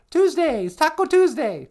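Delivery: high, loud little character; sidekick voice. Pretend. Sidekick answering what Tuesday is, high character voice. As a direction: pipe up in a high put-on voice.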